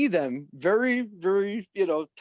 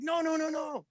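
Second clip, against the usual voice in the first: excited insisting; 10 semitones higher, 3 dB quieter than usual. Retold or imitated. Cousin insisting, retold.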